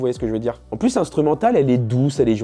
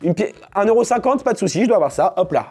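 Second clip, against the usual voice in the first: acting out at the bakery; 8 semitones higher, 3 dB louder than usual. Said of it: "des portefeuilles comme as".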